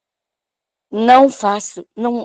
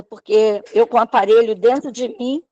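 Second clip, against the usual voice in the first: ordinary delivery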